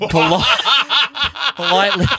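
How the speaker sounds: word broken by high laughter; helpless giggle mid-word; real cracking up. Real, not acted. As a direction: try to say it and burst out laughing. Cracks up at his own wording.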